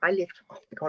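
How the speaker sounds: whispered, choking up; real tearful whisper; near tears. Real, not acted. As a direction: voice failing as tears come. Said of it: "I'm gonna cry".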